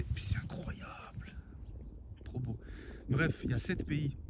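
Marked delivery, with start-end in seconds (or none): 0.0-2.6 awed whisper. Real, not acted. Awed at the view.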